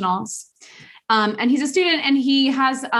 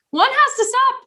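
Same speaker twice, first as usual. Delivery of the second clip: pitched-up emphasis. Retold or imitated. Rebuttal peak.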